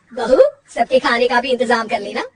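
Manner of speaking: loud mother-in-law calling orders to her daughter-in-law; an elder ordering the bahu about; bossy mother-in-law. Acted. (सास की हुक्म चलाती आवाज़)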